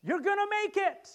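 high, loud, joyful proclamation; God's announcement. Retold or imitated. God speaking to sailors in the storm, high joyful proclamation. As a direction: announce it like heaven's good news. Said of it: "God shows up ... and says".

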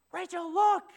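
high, loud call to his wife; a sudden thrilled shout across the room; excited shout. Retold or imitated. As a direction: call out to Rachel in delight. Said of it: "You wanna shout something".

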